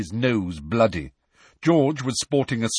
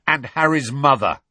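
angry, louder snarl; George; 5 semitones higher, 5 dB louder than usual. A narrator's reading. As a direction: snarl the added grievance. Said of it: "snarled George".

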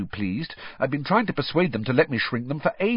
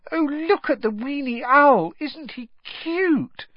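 put-on cooing girls. A narrator's reading. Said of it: "a group of third-year girls paused and said".

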